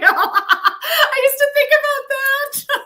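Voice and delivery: high, slow words through a laugh. Real, not acted. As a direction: giggle out the confession. Laughing at the weird idea.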